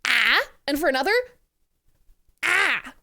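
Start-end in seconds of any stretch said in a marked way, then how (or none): 0.1-0.4 short, loud mock scream; playful yell in place of a statement; comic yell. Streamer making a "brave statement" to viewers, comic yell. 2.4-2.9 loud mock scream as a punchline; playful wordless outburst; comic yell. Streamer's second point to viewers, comic yell.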